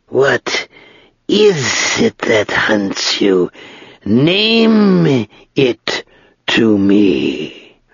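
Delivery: slow, drawn-out, dry dragon voice; great dry voice of an ancient dragon. Acted. Dragon speaks to Ged.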